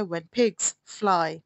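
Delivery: When pigs fly said very slowly, stress on pigs. Shown as a demonstration. Teacher repeating the proverb for students, very slowly. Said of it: "इसका क्या मतलब होता है".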